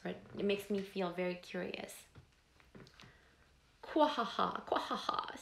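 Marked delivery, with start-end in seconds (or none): 3.8-5.4 frog laugh. Acted. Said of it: "somebody laughed loudly".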